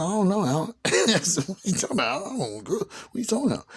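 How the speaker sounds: high-pitched mock protest; nervous denial. Retelling his denial to Alan about the girls, nervous denial.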